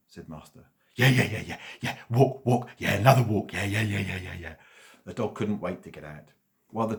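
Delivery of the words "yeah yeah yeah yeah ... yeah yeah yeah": excited dog. A narrator's reading. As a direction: bark out the yeahs like a thrilled dog. Eager dog wants walk.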